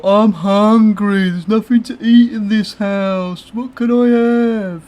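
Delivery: put-on high, slow whining teen; whiny hungry teenager. Typical teenage complaint imitated.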